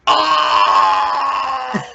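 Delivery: high, loud martial arts shout; cheesy dubbed film battle cry; samurai movie yell. Pretend. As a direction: let out a hammed-up kung fu yell. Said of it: "I love your samurai impression".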